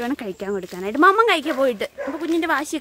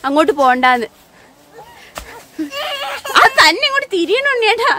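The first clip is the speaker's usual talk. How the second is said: calling out; 7 semitones higher, 8 dB louder than usual. Real, not acted. Mother calling loudly and high to her wailing child. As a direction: call out high and loud so the little one hears.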